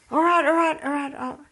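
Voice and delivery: high, rapid-fire mock capitulation; flustered surrender. Husband seeing the packed bag, flustered surrender.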